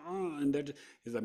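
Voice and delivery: ordinary talk, nothing marked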